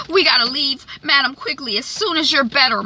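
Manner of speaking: high, pleading daughter voice; urgent daughter. Retold or imitated. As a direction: imitate a daughter urging her mother along. Voicing the daughter urging her mom.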